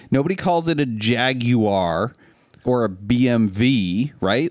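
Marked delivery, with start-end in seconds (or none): none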